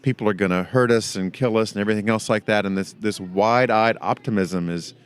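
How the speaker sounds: usual speaking voice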